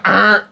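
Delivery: high buzzing "Uh"; imitating the access-denied buzzer; denial buzzer. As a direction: make a sharp rejecting buzz. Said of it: "it's like a buzzer".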